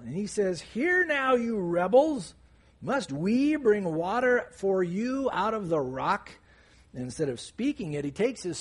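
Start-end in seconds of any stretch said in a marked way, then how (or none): 0.6-6.3 raised, higher mock scolding; acted angry yelling. Moses mad at the grumbling people, mock yelling. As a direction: play a fed-up leader telling off a crowd. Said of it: "he starts yelling at the people".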